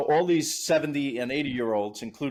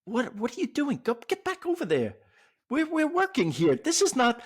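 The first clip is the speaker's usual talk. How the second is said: exasperated son; 10 semitones higher than usual. Retold or imitated. Son scolding father at work.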